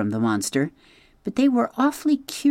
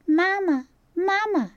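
very high tiny peep; peeping gosling. Acted. Newly hatched gosling peeping at the robot. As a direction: newborn chick calling for mama. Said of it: "peeped the gosling".

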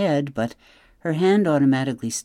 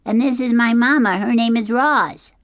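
shouting little gosling, very high and loud; high-pitched young creature's holler; Bright Bill; 12 semitones higher, 7 dB louder than usual. A narrator's reading. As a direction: call out an introduction over the waves. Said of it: "he shouted over the waves".

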